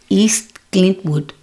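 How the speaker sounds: "East Clintwood" slow, with a hard k; mother's mispronunciation. Retold or imitated. Comic imitating her mother mispronouncing Eastwood. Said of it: "when she would say".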